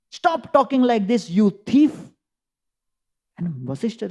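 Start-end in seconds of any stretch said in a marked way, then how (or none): 0.1-2.1 indignant rebuke. Acted. Bharat rebukes the sage.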